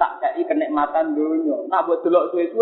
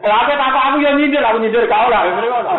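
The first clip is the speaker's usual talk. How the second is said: quick, loud, higher teasing protest; mock protest; 6 semitones higher, 4 dB louder, faster than usual. Preacher to audience, fast loud mock protest. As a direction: playfully protest being called a tease.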